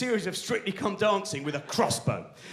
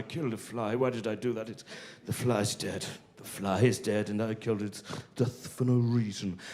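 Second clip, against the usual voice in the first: low, soft, mock-tragic lament; mock remorse; 7 semitones lower, 4 dB quieter than usual. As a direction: ham up the guilt over a dead fly. Remorseful fly-killer inner voice.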